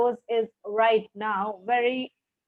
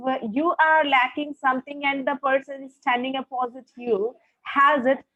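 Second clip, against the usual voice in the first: ordinary talk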